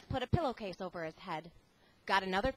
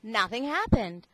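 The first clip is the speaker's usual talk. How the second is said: plain reading voice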